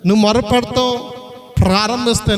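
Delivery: usual speaking voice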